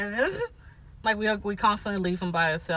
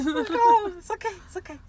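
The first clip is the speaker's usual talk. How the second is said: soothing; 12 semitones higher than usual. Pretend. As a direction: hush a sad stranger gently. Soothing a sad scare actor.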